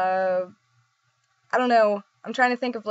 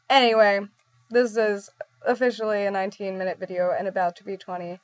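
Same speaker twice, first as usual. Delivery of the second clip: ordinary talk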